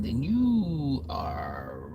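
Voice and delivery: mock game show host. Game show verdict, squeak on not.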